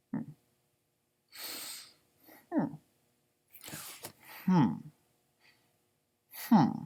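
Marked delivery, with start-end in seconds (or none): none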